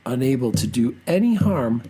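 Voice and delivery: ordinary delivery, nothing marked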